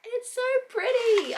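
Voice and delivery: high slow gushing squeal; squealing delight. Real, not acted. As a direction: coo over it in delight. Unboxing the Kindle.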